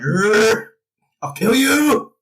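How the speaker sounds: strained growl, then a high, loud mock threat; hammed-up strangled fury; mock choking threat. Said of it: "and he's like".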